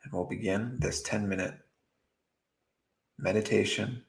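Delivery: soft, unhurried meditation voice with long pauses; guided meditation. Acted. Meditation teacher guiding beginners to drop worry, soft and calm. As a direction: ease them gently into stillness, softly.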